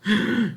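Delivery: high, loud gasp; startled gasp. A narrator's reading. Girl sees the bread already in the pig's mouth, startled gasp. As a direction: gasp in alarm.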